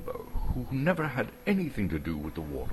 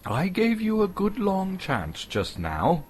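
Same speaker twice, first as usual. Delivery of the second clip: Bilbo; 12 semitones higher than usual. Acted. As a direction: blurt the answer out high and proud.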